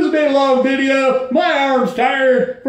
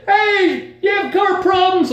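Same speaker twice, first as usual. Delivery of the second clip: much higher, bright call from a passing car; friendly girls calling; 9 semitones higher than usual. Retold or imitated. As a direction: call out to a stranded driver. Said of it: "They say".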